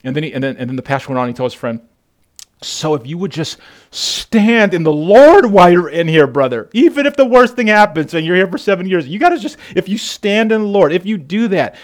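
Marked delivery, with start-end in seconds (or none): 2.6-11.8 raised, higher-pitched preaching plea; impassioned exhortation. Retold or imitated. Pastor urging jailed friend to stand firm, impassioned exhortation. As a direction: urge him on with a preacher's force.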